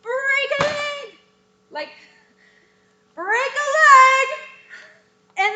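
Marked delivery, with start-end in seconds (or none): 0.0-1.2 mock yell. Shown as a demonstration. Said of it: "someone from off stage will probably yell". 3.1-4.3 high, loud call from off stage; loud cheering call; offstage yell. Shown as a demonstration. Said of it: "will probably yell".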